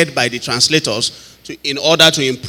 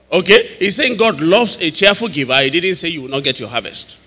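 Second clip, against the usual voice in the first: normal talking voice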